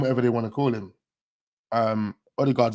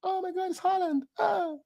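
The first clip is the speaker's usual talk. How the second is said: imitating a starstruck woman in a tiny high squeal; high, soft swoon, hammed up; mock excited fan; 6 dB quieter than usual. Nice-looking woman spots Haaland out walking, mock excited gasp. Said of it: "she got excited and her jaw just dropped".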